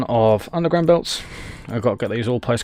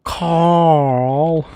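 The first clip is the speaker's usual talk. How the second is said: higher, exasperated drawn-out name; higher exasperated meme voice; 5 semitones higher, 3 dB louder than usual. Acted. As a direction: call the name in mock dismay. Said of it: "random knowledge of random meme".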